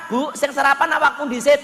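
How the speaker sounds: a husband telling his wife to eat first. Acted.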